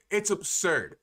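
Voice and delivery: ordinary talk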